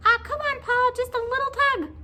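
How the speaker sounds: high, loud squeaky coaxing; squeaky pleading creature; urging little pigtail. A narrator's reading. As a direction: urge him on in a tiny high voice. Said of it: "urged the pigtail".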